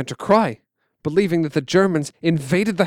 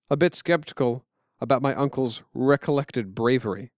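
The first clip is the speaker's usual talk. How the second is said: ordinary delivery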